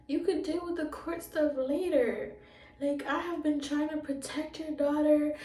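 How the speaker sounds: high and loud, urgent begging; urgent pleading. Retold or imitated. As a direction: plead hard for the girl's sake. Social worker begging mom on phone.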